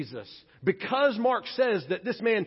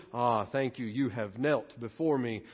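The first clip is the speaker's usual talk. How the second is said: Jesus imagined as smugly pleased; mock gracious welcome; 8 semitones lower, 5 dB quieter than usual. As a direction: lower and softer, mock gracious. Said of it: "Jesus does not respond with".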